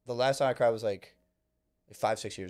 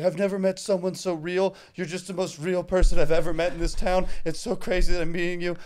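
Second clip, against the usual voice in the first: mock gushing; 5 semitones higher, 5 dB louder than usual. Retelling crying man's gush.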